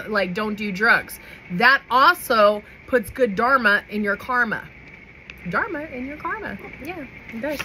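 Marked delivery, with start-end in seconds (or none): 5.5-7.6 higher, softer playful repeat; high soft teasing echo; playful singsong. Real, not acted. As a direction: savour the rhyme, a little sing-song. Repeats own phrase, playful aside.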